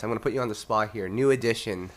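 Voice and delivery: usual speaking voice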